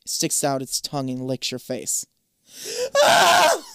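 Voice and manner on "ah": short, very high loud scream; scream of pain. A narrator's reading. Gargoyle's rough tongue scrapes your cheek, pained scream. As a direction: yelp out in pain. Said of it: "You scream in pain".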